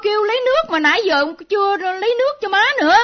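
loud, high, rushed scolding; urgent, exasperated shout for help; impatient calling out. Acted. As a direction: call sharply to a dawdling sister. Scolds sister, calling for water.

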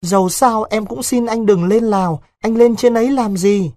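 put-on a frightened girl begging her lover to stay; pleading young girl. A narrator's reading. Vịnh begging Đối not to go to Laos, high and pleading.

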